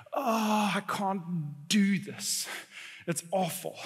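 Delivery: quiet, halting groan of defeat; weary despair. Acted. Person overwhelmed by life's hard times, quiet defeat. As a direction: say it softly, with pauses, as if giving up.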